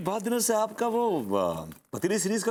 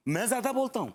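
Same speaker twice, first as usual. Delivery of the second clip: higher, indignant outburst; 5 semitones higher, faster than usual. Acted. Husband throwing wife's accusation back, indignant.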